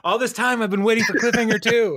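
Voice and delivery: high-pitched squeal through laughter; cracking up. Real, not acted. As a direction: squeak it out mid laughing fit. Laughing fit over the cliffhanger joke.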